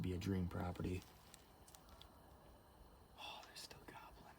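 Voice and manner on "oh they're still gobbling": soft excited whisper; whisper, delighted; hushed whisper. Real, not acted. Whispering near gobbling turkeys.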